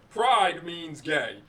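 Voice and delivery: slow, deliberate, each word spaced; very slow emphatic. Real, not acted. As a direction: spell it out slowly.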